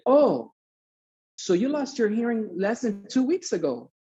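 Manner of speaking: imitating a surprised doctor, pitch jumping up; high, questioning "oh" as it dawns; sudden realization. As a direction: act out someone catching their mistake. Doctor realizes his mistake.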